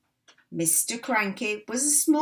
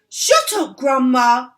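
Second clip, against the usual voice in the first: loud impatient snap; 5 semitones higher, 11 dB louder than usual. Acted. Cranky snaps at Grandma.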